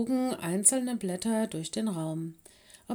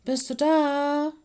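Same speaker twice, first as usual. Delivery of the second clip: high, loud, drawn-out question; searching call, raised and stretched; 7 semitones higher, 6 dB louder, slower than usual. A narrator's reading. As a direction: call slowly to someone in another room. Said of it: "rief er".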